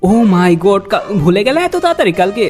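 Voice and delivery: ordinary delivery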